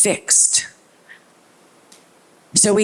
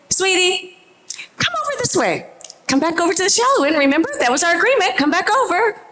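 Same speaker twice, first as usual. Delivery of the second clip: high, urgent but sweet call across the pool; calling out to child; 12 semitones higher, much faster than usual. Retold or imitated. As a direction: coax him back to the shallow end. Mother calling child back from water.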